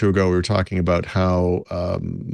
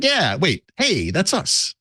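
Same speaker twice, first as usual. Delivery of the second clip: Microsoft voice; 11 semitones higher, much slower than usual. Retold or imitated. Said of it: "Microsoft's like".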